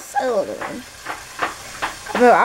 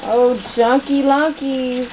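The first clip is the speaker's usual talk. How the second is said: silly pet names; 5 semitones higher, slower than usual. Real, not acted. Mom cooing a silly nickname at her son, slow baby talk. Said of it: "stop all these little weird, ugly names".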